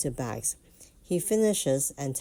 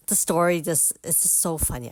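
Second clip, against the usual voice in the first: ordinary talk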